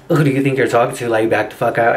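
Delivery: low, quick, confrontational dad voice; deep go-mode fighting voice; father's voice. Retold or imitated. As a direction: pitch it way down and challenge them. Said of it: "I call it my father's voice".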